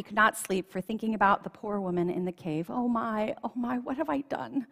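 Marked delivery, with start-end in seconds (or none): 2.7-4.7 a mild storybook wail, a bit higher; the king wails lightly over his guilt. A narrator's reading. Greedy king gently wailing at night over what he's done. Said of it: "he wailed".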